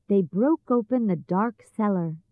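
plain reading voice

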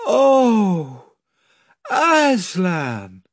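high despairing wail; the children learn they cannot return, despairing; despairing cry. A narrator's reading. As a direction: cry out in dismay. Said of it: "in despairing voices".

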